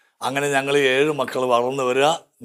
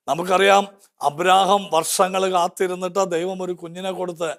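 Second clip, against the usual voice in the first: higher, fervent preacher voice; impassioned preaching; 5 semitones higher than usual. Acted. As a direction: rouse the congregation with rising fervour. Preaching Bible story. (ഉയർന്ന സ്വരത്തിൽ ആവേശത്തോടെ പ്രസംഗം)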